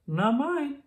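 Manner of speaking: put-on a small child answering the teacher. A narrator's reading. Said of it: "said one child".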